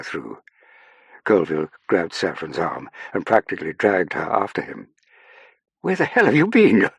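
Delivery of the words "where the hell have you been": loud, high, exasperated snap; snapping. A narrator's reading. Officer to Saffron as he drags her along, snapping. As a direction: demand it sharply, out of patience. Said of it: "he snapped".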